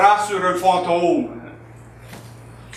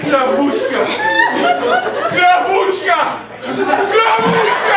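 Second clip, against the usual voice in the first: each call higher, last one slow and drawn out; rising joyful calls; 12 semitones higher, slower than usual. Acted. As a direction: call her name in rapture. Husband calls his wife's name.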